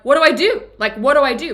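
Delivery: panicked plea. Retold or imitated. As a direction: plead for help as if you can't breathe. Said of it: "and I'm like".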